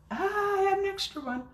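high delighted exclamation; pleased with a lucky find. Real, not acted. Delighted discovery.

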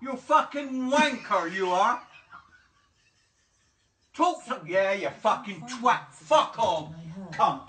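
man insulting the smart speaker; angry swearing. Real, not acted. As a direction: curse it out.

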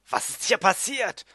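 called out high and loud; loud cry. A narrator's reading. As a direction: exclaim it so the whole house hears. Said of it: "rief ich so laut".

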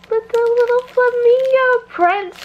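high, slow gushing squeal; squealing delight, drawn out; excited squeal. Real, not acted. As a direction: coo over the cute print. Gushing over snack bag prints.

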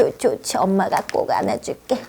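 soft, low, fast soothing murmur; mother cuddling her son at bedtime; hushed comforting. Acted. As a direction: hush him gently to sleep. Mother soothes child to sleep.

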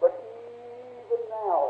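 very slow, soft, high-pitched even now; drawn-out hush. Acted. Preacher repeating Martha's words, slow, soft and high. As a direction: stretch each word out softly on a high note.

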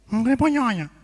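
comic nasal mumbled request; nasal speech impediment. Acted. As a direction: talk through the nose, words muddied. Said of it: "Agora vai falar fanhoso".

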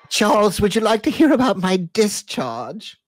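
high, loud, giggly lady's voice; mock Camilla. Parodying Camilla on the call to Charles, high loud mock voice. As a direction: ham up a coy posh lady, laughing.

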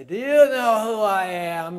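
loud, slow, high indignant demand; mock pompous outrage; entitled official. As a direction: bark it like a drunk official pulled over. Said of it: "and they say".

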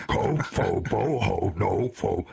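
low, booming alien syllables; Judoon language. Retold or imitated. Monster calling out what sounds like names, low alien chant. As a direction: drop the pitch and boom each syllable. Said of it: "I thought he was going".

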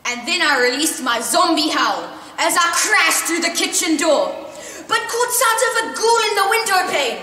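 high, loud, rushing climax; story climax read loud and high; building climax. A narrator's reading. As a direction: race to the scare, loud and high. Zombie howl crash scene.

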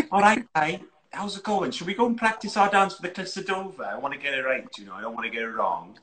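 lightly put-on Welsh voice, laughing at the start; a light mock of Amy's Welsh lilt; a hint of Welsh, playful impression. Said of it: "Then do properly".

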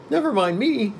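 very high put-on voice; pumpkinhead. A narrator's reading. Pumpkin man refusing help to walk, high voice. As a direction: brush off help in a high pitch. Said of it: "said Jack".

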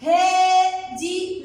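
slow "haaa-di" for هاء دي; long vowel. Shown as a demonstration. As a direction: hold the "aa" in هاء دي before going on. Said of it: "ممدود هاء". (مدّ الألف في هاء دي ببطء)

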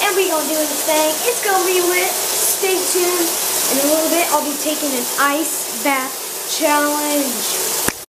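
loud, high, fast hype intro; hyped vlogger intro. Acted. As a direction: pump up the viewers for the challenge. Hyped video intro to camera.

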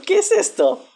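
"¿qué es esto?" chillado muy agudo y más fuerte; chillido de sorpresa. Real, not acted. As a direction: grita "qué" de pura incredulidad. Reacción a algo inesperado. ("¿qué es esto?" en un chillido muy agudo)

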